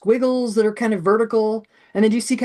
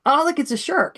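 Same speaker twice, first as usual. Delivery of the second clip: put-on higher, delighted kid pointing it out; eager kid; 7 semitones higher than usual. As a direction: play a thrilled student spotting it.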